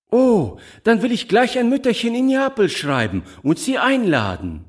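put-on high, joyful little girl; cheerful exclamation. A narrator's reading. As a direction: call out happily, pitched well up. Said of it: "rief Giulietta fröhlich". (fröhlich gerufen, mit hoher Stimme)

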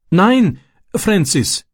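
high, urgent plea; pleading. A narrator's reading. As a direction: call out and beg him to stop. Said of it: "flehte er". (flehend, mit hoher Stimme)

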